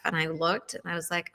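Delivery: ordinary talk, nothing marked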